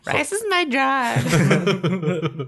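high, slow, laughing sing-song; drawn out pop-star croon; mock pop singer. Playful mock [name] lyric delivery.

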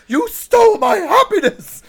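high, loud mock Swedish accent outcry; Greta's Swedish accent. Co-host mocking Greta to his boss in Swedish accent. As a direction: wail it in a hammed-up Swedish accent. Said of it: "in Greta's accent".

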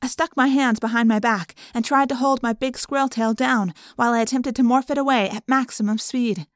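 ordinary delivery, nothing marked

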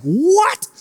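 high, squeaked disbelief; a hammed-up double take; shocked what. Retold shock at odd answer.

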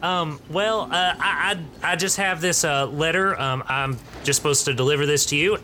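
put-on high, hesitant Southern teen; basic Southern accent. Teen messenger hands Leslie the letter, Southern accent. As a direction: mumble it shyly with a Southern accent. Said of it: "basic Southern accent".

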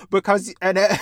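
ordinary delivery

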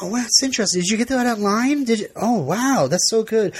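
high, gushing small-talk voice; gushing fake interest. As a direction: gush over the kilt like a bored fan. Mocking people's kilt small talk.

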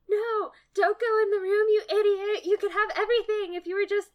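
high, loud exasperated shout; yelling at the screen. Retold or imitated. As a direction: yell a warning at a foolish heroine. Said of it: "younger self being like".

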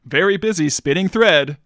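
booming radio host intro; radio announcer voice. Acted. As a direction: put on a big radio announcer build-up. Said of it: "you put on your radio announcer voice".

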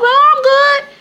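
very high, loud, hammed-up answer; exaggerated chirpy reply; effusive reply. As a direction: gush it back in mock Southern friendliness. Acted reply to effusive greeting.

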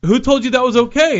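mock furious worker, pitched high; angry high yell. Teamster yelling at Tommy Wiseau, high and furious. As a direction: rant at him like an outraged Teamster. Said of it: "and he's like".

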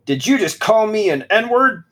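lightly voiced tough kid calling him out; mock angry Yard. Reading Yard's threat to Brett, mock indignant.

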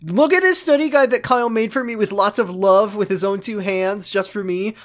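put-on very high, whiny loud kid voice; Josh. Josh complaining to Mom about Kyle, hammed-up high whine. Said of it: "Josh goes".

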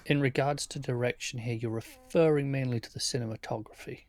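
usual speaking voice

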